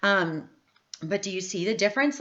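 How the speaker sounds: normal talking voice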